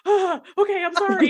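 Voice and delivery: high, laughing embarrassed apology; flustered nurse. Retold or imitated. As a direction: backpedal like a caught-out nurse. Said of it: "And the nurse went".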